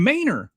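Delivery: "Manor" corrected to "MAY-ner"; Texas pronunciation. Retold or imitated. Friend correcting the town name, "Manor" as "MAY-ner". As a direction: stretch the first vowel of "Manor" into "main". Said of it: "Who pronounces it Manor?".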